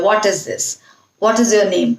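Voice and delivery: "What" with a rounded "aw" vowel; careful "What" with crisp t; correct "what". Shown as a demonstration. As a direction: clear teacher model of "What is this". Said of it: "what जो है properly pronounce होना चाहिए".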